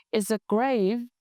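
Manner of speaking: usual speaking voice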